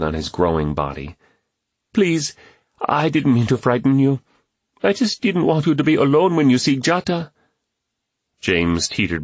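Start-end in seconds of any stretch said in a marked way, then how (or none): 1.9-7.3 put-on higher, pleading young boy; boy's voice. A narrator's reading. Kwame apologising to James, pleading boy's voice. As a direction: plead like a small earnest boy.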